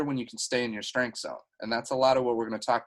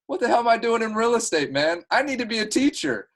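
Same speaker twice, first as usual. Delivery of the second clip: imitating Greg excited; 9 semitones higher, 5 dB louder than usual. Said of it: "He's like".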